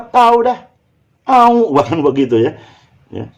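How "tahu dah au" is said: high, loud dunno reply; don't-know brush-off. Shown as a demonstration. Answering a friend's question, don't-know brush-off. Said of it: "itu kan jadi tidak tahu".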